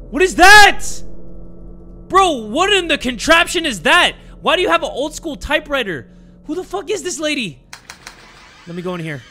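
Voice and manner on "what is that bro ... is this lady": high, loud, startled questioning; loud disbelief. Real, not acted. Player spotting an old school typewriter, loudly baffled. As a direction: burst out baffled at what you see.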